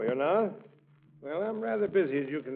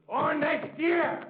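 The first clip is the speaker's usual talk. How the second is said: annoyed; 13 semitones higher, 4 dB louder, much slower than usual. Acted. Bullard brushing off Gildersleeve, annoyed.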